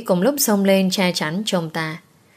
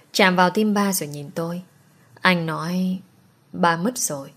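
usual speaking voice

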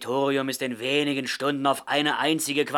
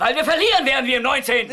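bitter laughing retort; 10 semitones higher, 5 dB louder, faster than usual. Acted.

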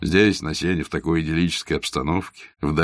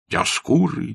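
Диоскуры said high and bright, falling; Диоскуры, high and eager; eager correction; 10 semitones higher, slower than usual. A narrator's reading. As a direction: proudly announce Диоскуры. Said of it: "повторил Василий Иванович".

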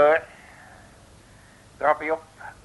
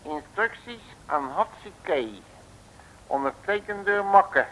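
usual speaking voice

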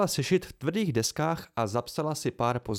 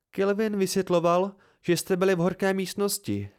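higher-pitched female medic explaining; higher character voice, informing a patient; Mola, explaining; 6 semitones higher than usual. A narrator's reading. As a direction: lift the voice for a matter-of-fact healer. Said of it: "pravila Mola".

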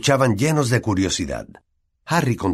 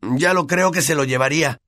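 understated raised-voice objection; 6 semitones higher than usual. A narrator's reading. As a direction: speak up in protest. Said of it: "alzando la voz más que Dean".

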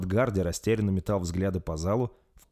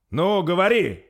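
higher, louder irritated cry; irritated outburst; 11 semitones higher, 8 dB louder, slower than usual. A narrator's reading. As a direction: snap at him to speak up. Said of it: "раздражённо вскрикнул Рольф". (Рольф раздражённо кричит на лорда Свана)

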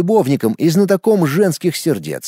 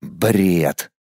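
low dismissive one-word retort; lower, scornful dismissal; contemptuous; 7 semitones lower than usual. A narrator's reading. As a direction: wave it away with scorn. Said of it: "презрительно отмахнулся я".

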